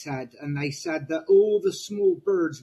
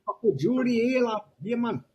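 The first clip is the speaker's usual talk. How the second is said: playful mock Geordie, pitched up; Geordie accent; 5 semitones higher, faster than usual. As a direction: put on a higher Geordie accent for a teasing mate. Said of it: "You've got the Geordie".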